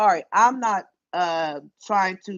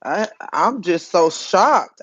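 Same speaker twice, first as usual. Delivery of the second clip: ordinary delivery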